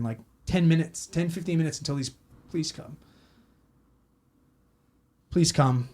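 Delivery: ordinary talk, nothing marked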